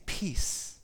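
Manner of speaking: a breathy, voiceless word; whispered peace. Acted. God hushing an anxious believer, whispered. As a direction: whisper it like a calming hush.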